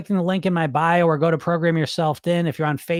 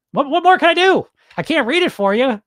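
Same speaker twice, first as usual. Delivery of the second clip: exasperated excitement; 9 semitones higher, 5 dB louder than usual. Real, not acted. Exasperated over free book offer.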